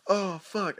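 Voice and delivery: high, loud panicked curse; a ten-year-old realising he's bleeding; scared oh fuck. Retold or imitated. Kid sees blood from his nose, panicked. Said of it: "And I was like".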